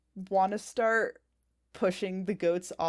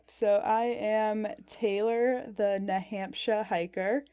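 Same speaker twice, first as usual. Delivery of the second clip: usual speaking voice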